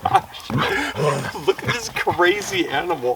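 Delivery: high, delighted oh my gosh; excited squeal. Real, not acted. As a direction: squeal at the crazy dog. Dog bounds up, owner thrilled.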